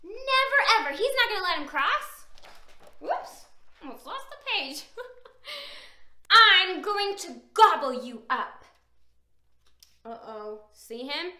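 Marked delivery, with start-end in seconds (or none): 6.3-8.6 loud story-time troll threat; threatening troll. A narrator's reading. Troll threatening to gobble Little Billy, mock menace. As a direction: threaten loudly, playfully as the troll.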